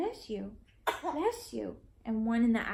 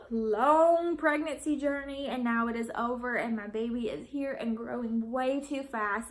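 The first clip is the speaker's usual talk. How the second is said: normal talking voice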